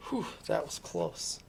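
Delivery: soft, whispery sigh of relief; acted relief. Mouse relieved the owl flew off, soft and whispery. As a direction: let it out under your breath, relieved.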